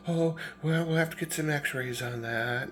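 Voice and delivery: high, very soft nurse voice; imitated soothing nurse, hushed and lilting; pretty nurse. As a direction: coo gently at an injured child. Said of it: "And she's like".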